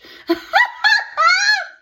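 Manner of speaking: high shrieking laugh; loud laugh. Real, not acted. Reader laughing at an interruption, loud laugh. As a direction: burst out laughing.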